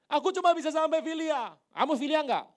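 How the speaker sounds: very high, loud outcry; impassioned shout. Acted. Peter admits he reaches only filia, shouting. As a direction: shout it with full preaching force. Acts exchange with Jesus, shouted.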